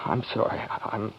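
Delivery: the fight gone out of him, barely audible; ashamed father, whispering he must leave; broken apology. Acted. George collapses into quiet remorse.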